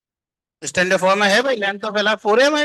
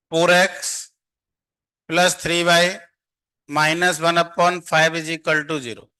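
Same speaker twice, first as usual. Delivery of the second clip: slow dictation; much slower than usual. Shown as a demonstration. Slowly dictating equation to students.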